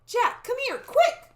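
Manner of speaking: high, loud urgent call; shrieky storybook summons. A narrator's reading. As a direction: call your brother over excitedly. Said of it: "called Annie".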